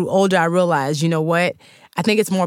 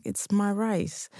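slow, softer shy answer; small quiet voice; 3 semitones higher, 8 dB quieter, much slower than usual. Retold or imitated. Child answers classmates shyly.

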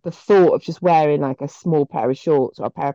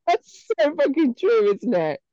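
high laughing agreement; 6 semitones higher than usual. Real, not acted. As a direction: laugh through the words.

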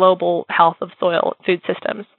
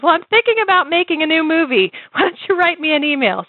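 higher, pointed mentor's question; Deborah voice; 10 semitones higher than usual. Retold or imitated. Deborah pushing Jessie about her life, higher insistent voice. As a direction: press her on her life choices, pitched up. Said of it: "And she goes".

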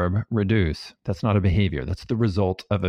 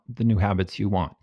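ordinary talk, nothing marked

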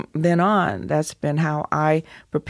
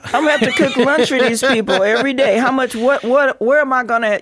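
higher, louder and fast, half laughing; excited outburst; 7 semitones higher, 6 dB louder than usual. Real, not acted. Actress joking she'll cook for the stars, excited and laughing. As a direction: blurt it out in amused panic. Laughing panic about cooking lunch.

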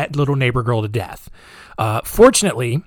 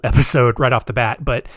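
ordinary delivery

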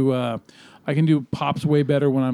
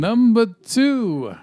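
slow, higher announcer call; awful announcer guy; 6 semitones higher, much slower than usual. Acted. Host calls the next countdown item, hammy announcer voice. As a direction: draw out the countdown number like an announcer. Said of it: "You're like an awful announcer guy".

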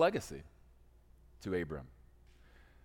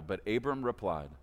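usual speaking voice

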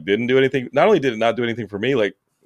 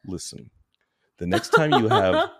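hushed Kathy Bates menace; 4 semitones lower than usual. Retold or imitated. Friend imitated as a creepy obsessive, murmured and hushed. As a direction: lean in and murmur like an obsessed fan. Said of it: "you're Kathy Bates in Misery".

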